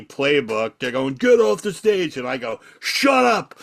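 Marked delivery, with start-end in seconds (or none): 1.1-2.2 high, loud mock heckle; heckling yell. Retold crowd heckling the comedian. 2.8-3.5 high, loud retort to hecklers; angry shout. Retold or imitated. Retold comeback to hecklers.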